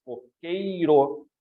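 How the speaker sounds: "coqueiro" very slow, all three syllables drawn out. Shown as a demonstration. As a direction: spell out "coqueiro" as co-quei-ro, each syllable full. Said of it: "todas as três sílabas bem pronunciadas".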